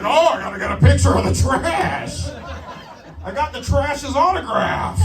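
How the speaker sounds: high-pitched, hammed-up excitement; mock starstruck tourist.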